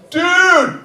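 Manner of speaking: shouting college dude; party bro. Retold or imitated. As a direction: yell over the party noise. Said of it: "when I'm at a party".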